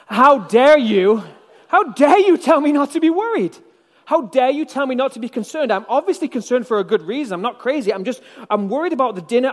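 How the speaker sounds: indignant outburst. Pretend. Martha snapping back at Jesus, indignant and loud. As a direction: rail back at Jesus, offended. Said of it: "how would we respond".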